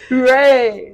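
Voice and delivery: mock high cheer. Repeating the quote.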